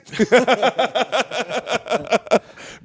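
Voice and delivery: high, drawn-out laughter; real laughter at his own joke; long laugh. Real, not acted.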